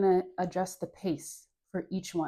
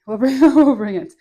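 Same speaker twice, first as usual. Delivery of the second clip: laughing; 5 semitones higher, 14 dB louder than usual. Real, not acted. Laughing reassurance.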